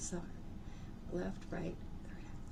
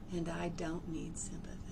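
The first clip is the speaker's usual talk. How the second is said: whisper; 3 semitones lower, 8 dB quieter than usual. Real, not acted. Whispered affirmation.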